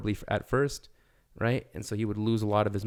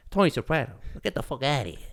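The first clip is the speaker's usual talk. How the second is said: higher, punchy mob boss impression; Tony Soprano; 4 semitones higher, 4 dB louder, faster than usual. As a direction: throw someone out like a mobster. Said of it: "done impressions, you know, of, of actors".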